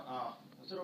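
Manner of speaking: normal talking voice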